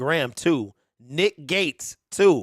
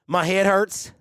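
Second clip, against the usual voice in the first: usual speaking voice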